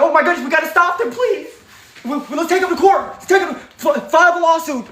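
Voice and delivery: high, fast, panicked pleading; mock panic. Mocking opponents scrambling to stop them, high panicky voice. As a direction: ham up their frantic fear of losing. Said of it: "they running around like".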